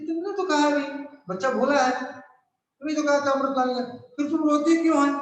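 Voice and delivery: put-on a boy asking his crying mother why; child Rahul. Rahul asks his weeping mother why she cries, high child voice. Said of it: "बोलती".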